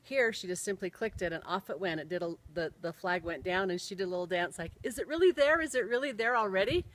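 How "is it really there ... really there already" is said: higher, louder burst of delight; thrilled disbelief, pitched up; excited retelling. Retold or imitated. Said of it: "she was so excited".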